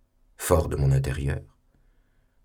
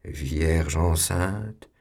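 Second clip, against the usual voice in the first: very slow, each syllable stretched; drawn-out syllables; much slower than usual. Acted. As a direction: stretch out Vierge enceinte mockingly. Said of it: "prolongeait chaque syllabe avec un ricanement".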